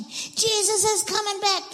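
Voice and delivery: high-pitched demonstration yell. Shown as a demonstration. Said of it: "Yell as loud as you can".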